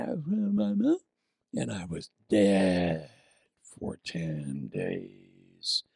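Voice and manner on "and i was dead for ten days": much lower, very slow and drawn out; dramatic deadpan. Real, not acted. Preacher telling of ten sick days, low and slow. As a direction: sink the voice low and slow for comic misery. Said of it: "comic drop into misery".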